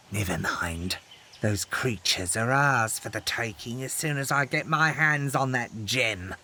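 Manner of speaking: gleeful villain. Acted. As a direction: relish every word like a greedy villain. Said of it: "Stryker declared gleefully".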